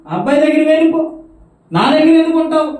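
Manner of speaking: high, loud taunting shout; angry husband. Retold or imitated. Husband tormenting his wife, loud mocking shout. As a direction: drive her away with a shout. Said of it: "torture చేస్తున్నాడు".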